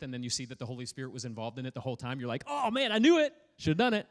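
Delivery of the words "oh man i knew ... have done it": mock regret. Regret after being proven wrong.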